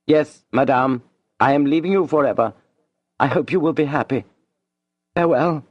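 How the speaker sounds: cool, composed farewell; coldly. A narrator's reading. Julien rising to leave Madame, cold and composed. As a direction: say goodbye without warmth. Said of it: "He added coldly".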